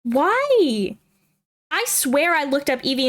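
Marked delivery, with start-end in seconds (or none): 0.0-1.0 drawn-out, higher wail of why; mock lament. Real, not acted. Vlogger bemoaning her duplicate name, mock lament. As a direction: lament it in playful exasperation.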